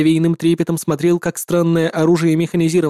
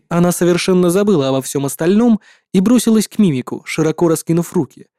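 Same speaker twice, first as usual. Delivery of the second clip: ordinary delivery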